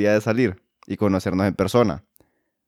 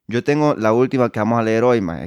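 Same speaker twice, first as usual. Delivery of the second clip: ordinary talk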